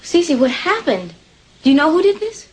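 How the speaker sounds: high, shocked questioning; alarmed. Acted. Woman asking Cece who did this, alarmed. Alarmed at what has happened.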